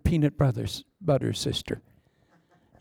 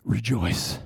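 normal talking voice

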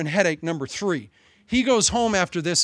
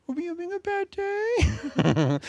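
much higher, slow, babyish mock-sympathy voice; mock pity; 13 semitones higher, slower than usual. Preacher teasing the congregation in a high mock-pity voice. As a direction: coo it like asking a sulking child.

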